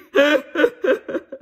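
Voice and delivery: real burst of loud laughter. Real, not acted. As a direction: crack up at your own impression. Laughing at own impression.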